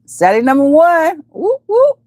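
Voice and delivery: high, drawn-out announcement rising into whoops; excited cheer. Real, not acted. Host hailing Sally as number one, excited cheer. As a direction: call it out high and slow, then whoop.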